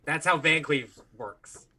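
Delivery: normal talking voice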